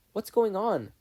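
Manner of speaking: put-on high, loud boy's question; alarmed boy. Steven arriving at the scene, high and alarmed. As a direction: burst in alarmed.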